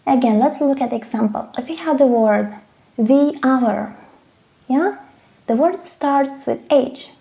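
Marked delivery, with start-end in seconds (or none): 3.0-4.0 THE said "thee" before hour. Shown as a demonstration. Teacher showing a silent-H word, THE as "thee". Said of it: "we are pronouncing once again article".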